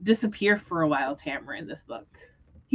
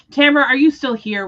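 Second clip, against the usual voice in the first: louder, higher call to Tamara; 5 semitones higher, 7 dB louder than usual. Real, not acted. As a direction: call out to catch her still listening. Catching Tamara listening to spoilers.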